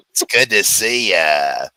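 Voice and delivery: slow, loud imitation of a woman's voice; put-on drawn-out greeting voice; ex-wife's speaking voice. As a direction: imitate her, dragging out each word. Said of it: "her normal speaking voice is".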